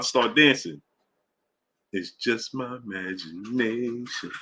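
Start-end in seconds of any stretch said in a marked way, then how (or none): 1.9-4.3 low, soft, slow crooning. Pretend.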